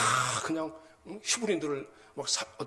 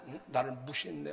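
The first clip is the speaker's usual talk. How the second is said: normal talking voice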